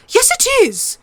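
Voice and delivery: a spoiled prince digging in; petulant boy contradicting the girl; insisting. A narrator's reading. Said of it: "Joffrey insisted".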